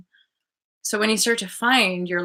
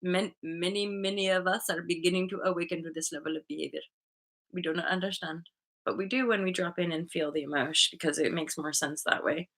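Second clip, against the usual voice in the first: quiet reply; 8 dB quieter, faster than usual. Retold or imitated. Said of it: "And I'm like".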